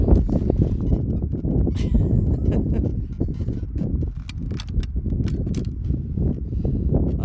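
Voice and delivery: breathless airy laughter; flustered laugh. Real, not acted. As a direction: laugh helplessly at the chaos. Umbrella falling over, flustered.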